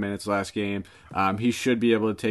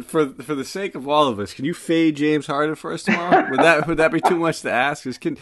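laughing tease; 6 semitones higher than usual. Real, not acted. Teasing request, laughing.

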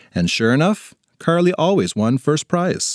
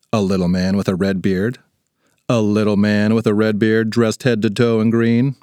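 ordinary delivery

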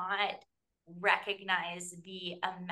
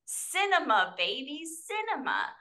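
mock rave; 7 semitones higher, 4 dB louder than usual. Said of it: "I'm like".

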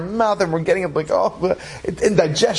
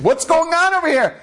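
much higher, exclaimed bewilderment; acted confusion; 12 semitones higher than usual. Confused Rav as everyone runs out screaming, acted bewilderment. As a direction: cry it out in mock alarm. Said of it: "The Rav is so confused".

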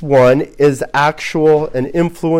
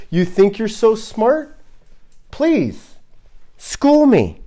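mocking, climbing much higher; sarcastic God; 6 semitones higher, slower than usual. God taunting Job to school him, mocking. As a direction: taunt him to teach you.

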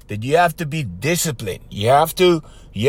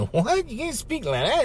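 mock incredulous listener; 8 semitones higher, 6 dB quieter than usual. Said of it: "you guys are going".

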